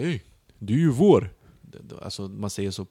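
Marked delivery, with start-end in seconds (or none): 0.1-1.3 loud, higher, hearty mock greeting; Serb man's greeting. The Serb on the train greeting her, loud and hearty. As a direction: clap him on the shoulder with your voice. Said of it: "han bara".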